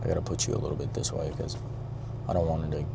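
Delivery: real hush while reframing camera. Real, not acted. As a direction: keep it down so she won't notice. Hiding girl from camera, hushed.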